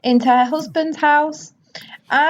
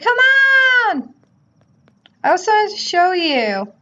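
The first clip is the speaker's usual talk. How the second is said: voicing the man; 9 semitones higher than usual. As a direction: shout to her like an excited suitor. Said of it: "He's like".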